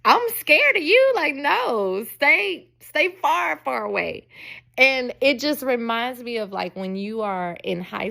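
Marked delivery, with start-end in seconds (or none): 0.0-4.3 mock scared. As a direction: squeal it like a playful fake fright. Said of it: "I'm like".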